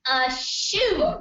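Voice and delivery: loud, high sneezed punchline; mock sneeze. Nurse delivering a leather sneeze joke, sneezed loudly. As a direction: burst it out like a big fake sneeze. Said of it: "sounds like a sneeze".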